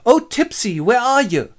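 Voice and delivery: high, loud lovelorn calling; hammy Pyramus. Acted. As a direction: call out like a bad amateur tragedian. Pyramus calls his lover.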